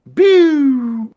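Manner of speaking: loud, high falling whoop; imitated police siren. Storyteller sounds out police arriving suddenly, siren whoop.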